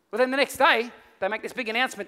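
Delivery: ordinary talk, nothing marked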